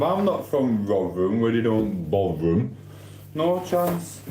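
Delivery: normal talking voice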